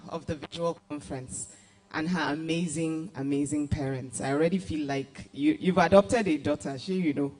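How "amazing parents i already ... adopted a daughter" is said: ordinary delivery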